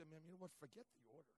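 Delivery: quiet mock snap. Preacher recalling his muttered complaint, quiet and curt. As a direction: say it under your breath, irritated. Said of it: "I wanted to say".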